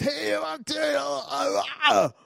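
high, loud, breathless excited shouting; hysterical. Retold or imitated. Woman running into town, shouting hysterically to everyone. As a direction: blurt it out like she can't contain herself. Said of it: "ganz hysterisch".

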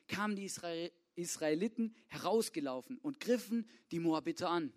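ordinary talk

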